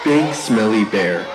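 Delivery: plain reading voice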